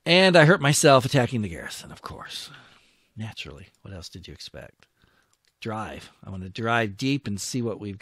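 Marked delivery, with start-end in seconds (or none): 0.0-1.9 louder, higher, faster; fed-up rising complaint; exasperated. Real, not acted. Complaining about losses. 1.9-4.9 quiet, muttered-down irony; resigned sarcasm, softer; dry sarcasm. Real, not acted. Sarcastic after loss.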